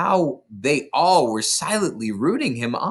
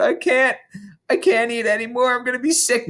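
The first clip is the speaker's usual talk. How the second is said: put-on pleading boy; 12 semitones higher than usual. A narrator's reading. Imagined boy begging.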